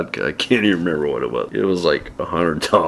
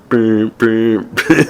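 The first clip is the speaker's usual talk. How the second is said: mock phone beeps, higher and louder; imitated busy beep; 4 semitones higher, 4 dB louder, much slower than usual. Phone wouldn't connect, mimicking the beep. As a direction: beep like a dead phone line. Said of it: "the phone was like".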